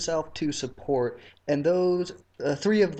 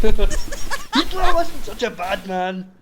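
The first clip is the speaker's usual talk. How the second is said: mock German accent; 5 semitones higher, 9 dB louder, much slower than usual.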